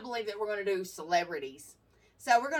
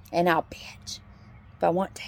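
whispered tag line; 5 semitones lower, 5 dB louder than usual. Real, not acted. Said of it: "whispered closing tag".

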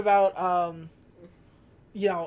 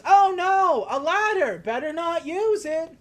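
high, loud, hammed-up fake dismay; mock fake-innocent kid; 8 semitones higher, 7 dB louder, slower than usual. Kid taunting burglar about the ladder, hammed-up fake dismay. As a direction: bait the burglar with a sing-song fake alarm. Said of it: "And the kid goes".

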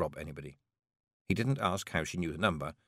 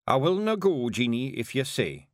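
Rob's gruff Scots; 5 semitones higher than usual. Acted. Rob offers Jeanie to stay, in a subdued Scots voice. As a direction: give in reluctantly.

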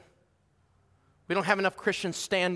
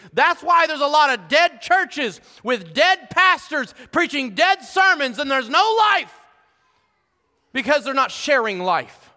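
loud, high, driving preacher's build; fiery preaching; 10 semitones higher, 10 dB louder than usual. Acted. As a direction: preach it hard to the congregation. Preacher building to full force.

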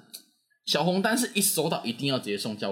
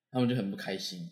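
usual speaking voice

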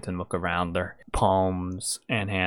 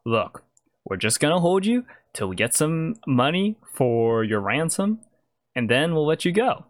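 higher-pitched kidnapper talking down a captive; higher voice, trying to sound reasonable; reasoning kidnapper; 7 semitones higher, 3 dB louder than usual. Acted. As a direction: bargain with your hostage in a raised voice. Game master plays kidnapper.

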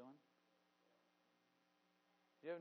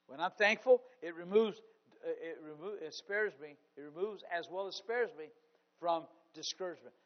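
full-force preaching; 3 semitones higher, 14 dB louder than usual. Acted. Preacher urging the congregation against fear, shouting. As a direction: shout it like the sermon's peak.

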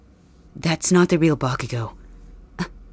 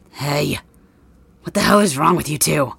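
ordinary talk

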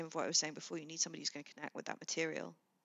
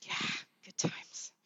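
whispering; much slower than usual. Real, not acted. Fond memory of film.

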